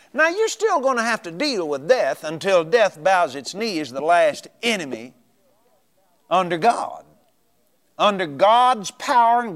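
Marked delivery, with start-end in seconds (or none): none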